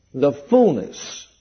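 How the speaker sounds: "fullness" set apart and drawn out slowly; emphasis on fullness. Real, not acted. Preacher underlining a key word for the congregation, emphasis on fullness. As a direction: stretch out "fullness" after a pause. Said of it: "I want to emphasize the word fullness".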